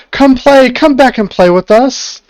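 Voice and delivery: high, loud sing-song calling from the mist; luring fog women. Acted. Unseen women in the fog luring the party, loud high calling. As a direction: call them back sweetly, like sirens in the fog. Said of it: "you hear the calling of these women".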